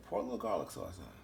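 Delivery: low, soft, quick mutter to himself; quiet aside. Real, not acted. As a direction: toss it off under the breath as he pours.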